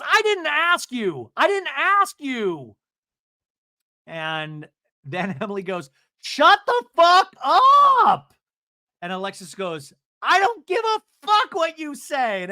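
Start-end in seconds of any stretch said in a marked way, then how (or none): 0.0-2.7 high, loud, drawn-out words; mock yelling in a quarrel; imitated angry retort. Acts out Emily snapping at Alexis. 6.2-8.3 very high, loud, stretched scream; hammed-up screaming outburst; imitated furious yell. Acts out Emily's outburst in quarrel. 10.2-12.4 high, loud, drawn-out screech; hammed-up quarrel shout; imitated angry yell. Acts out Alexis yelling back.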